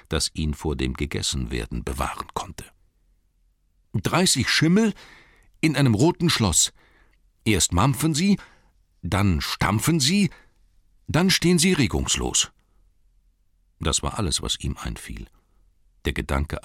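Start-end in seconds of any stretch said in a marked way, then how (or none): 3.9-12.5 high, loud hobbit recital; nervous hobbit. Acted. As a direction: recite it anxiously. Bilbo recites riddle.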